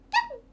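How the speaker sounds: 甲高く大きな「キャン」; 子犬みたいに鋭く鳴く; 犬の鳴き真似. Pretend. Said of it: "ワンって言わんくない？". (高く大きな声で犬の鳴き真似)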